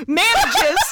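high voice breaking into laughter; laughing. Real, not acted. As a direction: laugh through the word. Laughing at the toast line.

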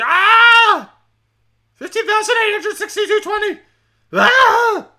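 shrieking high and loud, laughing through it; mock rage screams. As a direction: scream like a maniac behind the wheel. Raging Batman-style drive home.

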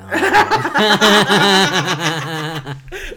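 loud high laugh bursting out; burst of laughter. Real, not acted. Friend hearing Two Girls One Cup, loud laugh. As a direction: crack up at the memory.

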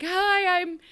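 high, bright excited TikTok storyteller; mimicking a TikTok girl. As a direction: chirp it out like a breathless fan video. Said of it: "a girl miming out".

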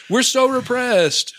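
high, slow mocking whine. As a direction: whine it like a self-pitying victim. Mocking Christians' complaints.

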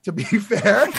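breaking into laughter mid-phrase; laughing. Real, not acted. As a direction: laugh through the words. Laughing at own verdict.

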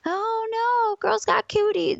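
high, drawn-out childish whine of mock horror; mock five-year-old. Player mocking boys who fear losing to a girl, childish singsong. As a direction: squeal it like a little kid on the playground. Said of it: "we're five again, five years old".

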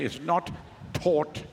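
South African rounded "aw" in "taught" and "caught"; proper South African accent. Shown as a demonstration. Preacher coaches them to say it, proper South African accent. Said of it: "Say it in a proper South African accent".

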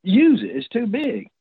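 high-pitched, exasperated retold scolding; acted-out incredulous nagging; irritated. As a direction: complain like fed-up friends. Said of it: "irritated everybody else".

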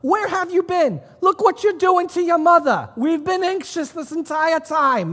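mock-scold a child in a high voice; exaggerated frantic parent telling off a child; hammed-up scolding parent. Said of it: "they don't talk like that".

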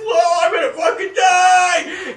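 high, loud, slow laughing wail; mock terror. Bob as the creature moves, loud laughing mock wail. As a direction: wail it like certain death, half laughing. Bob sure he'll die.

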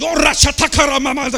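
loud, sung ecstatic tongues; speaking in tongues. Shown as a demonstration. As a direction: shout and chant the tongues, full force. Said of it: "Speaking in tongues".

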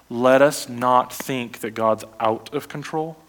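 ordinary talk

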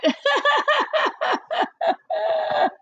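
long high-pitched giggle; real laughter. Real, not acted. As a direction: burst into giggles. Laughing at herself.

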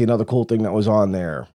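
usual speaking voice

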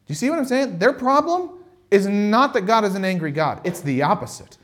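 ordinary talk

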